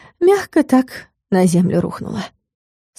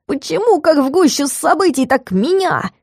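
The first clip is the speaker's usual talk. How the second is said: offended Dakar; 7 semitones higher than usual. A narrator's reading. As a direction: whine like a put-upon little devil. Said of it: "обиженный голос Дакара". (обиженный голосок чёрта)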